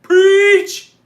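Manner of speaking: loud, high-pitched yell; shrill drawn-out shout of approval; mock congregation cheer. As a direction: holler it like a worked-up churchgoer.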